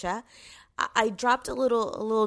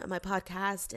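ordinary delivery

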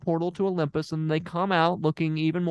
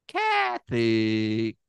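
Kathy said lower and stretched long; low drawn-out name; 4 semitones lower than usual. Shown as a demonstration. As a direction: drag Kathy out low and slow.

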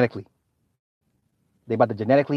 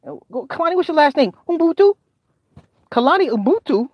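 very high, disbelieving questions; dad quizzing the girl, much higher than his own voice; suspicious dad; 11 semitones higher than usual. Retold or imitated. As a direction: stretch the name out in disbelief. Dad questions kid's friend.

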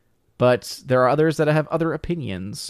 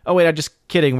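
ordinary delivery

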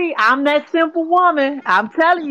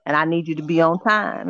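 low, soft flirty invite; sexy voice; 9 semitones lower, 3 dB quieter than usual. Shown as a demonstration. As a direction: lower your voice, make it sultry. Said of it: "I just give him the sexy voice".

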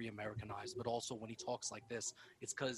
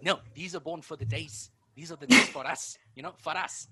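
mock South African; 5 semitones higher, 12 dB louder than usual. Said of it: "It's like".

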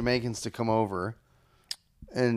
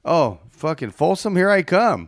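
mock cheer; 4 semitones higher, 6 dB louder than usual. Mock prison road trip cheer.

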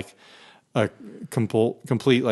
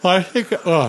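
talking through a yawn; 6 semitones higher than usual. Real, not acted. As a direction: yawn mid-sentence. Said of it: "excuse me, I'm yawning".

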